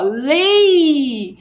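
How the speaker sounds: "Lei" stretched long right through to the i; lengthened syllable. Shown as a demonstration. Teacher showing the syllable is longer, "Lei" drawn out. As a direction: hold "Lei" so the final i is heard. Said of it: "é um pouquinho mais longo".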